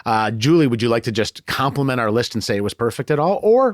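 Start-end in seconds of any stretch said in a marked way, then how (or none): none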